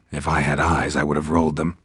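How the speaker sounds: ordinary delivery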